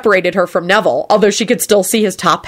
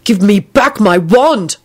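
slow, emphatic angry demand; barked demand; 3 semitones higher, slower than usual. A narrator's reading. Neville to the warlock holding his wand, barked. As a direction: bark each word at him. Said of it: "Neville barked at the warlock".

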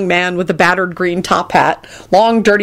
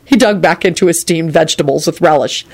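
ordinary delivery, nothing marked

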